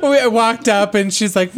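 ordinary talk, nothing marked

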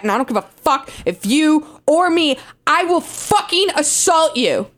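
ordinary delivery

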